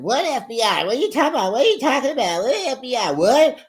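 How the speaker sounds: hammed-up wife in shock; mock outraged disbelief. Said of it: "And then here go Jen".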